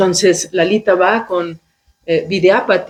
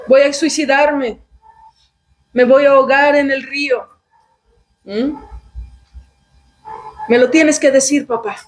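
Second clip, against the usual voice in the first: higher, dramatic emotional blackmail; threatening daughter; 5 semitones higher than usual. Retold or imitated. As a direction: threaten to jump, begging. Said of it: "ella vuelve a utilizar sus, sus mañas". (hija amenazando con suicidarse, voz aguda y dramática)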